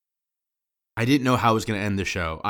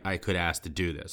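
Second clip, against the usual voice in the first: normal talking voice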